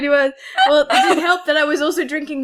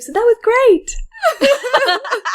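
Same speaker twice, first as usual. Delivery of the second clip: drawn-out high gush; mock excitement; 11 semitones higher, much slower than usual. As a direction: stretch it out, hammed-up joy. Mock enthusiasm.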